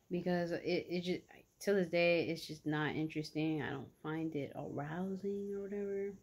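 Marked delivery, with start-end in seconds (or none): none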